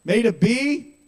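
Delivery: high, loud incredulous question; mock-horrified outburst; appalled disbelief. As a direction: blurt it out, pitch leaping upward. Acts out child's disbelief.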